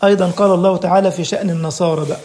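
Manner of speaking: ordinary talk, nothing marked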